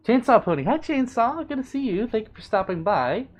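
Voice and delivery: high, bright welcome; gleeful greeting. Real, not acted. Streamer greeting viewer Chainsaw, high and gleeful. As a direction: greet a friend who just arrived.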